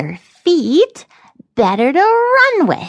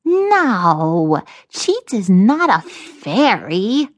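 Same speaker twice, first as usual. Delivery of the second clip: slow, loud, offended elf; sneering elf; 5 dB louder, much slower than usual. A narrator's reading. Cheats is mistaken for a fairy, sneering. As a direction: drag out the denial with scorn. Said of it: "Cheats sneered at the very thought".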